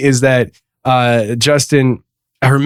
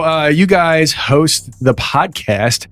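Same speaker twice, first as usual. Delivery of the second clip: normal talking voice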